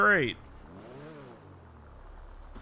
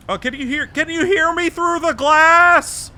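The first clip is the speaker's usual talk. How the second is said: cheek pressed to glass; 12 semitones higher, 11 dB louder than usual. Real, not acted. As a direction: shout up against the window. Asking through the car glass.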